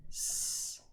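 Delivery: a soft hissed s alone; unvoiced s by itself; isolated s sound. Shown as a demonstration. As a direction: breathe out just s. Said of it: "Let's try that together".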